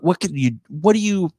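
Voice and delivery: normal talking voice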